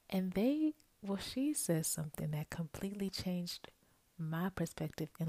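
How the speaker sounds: ordinary talk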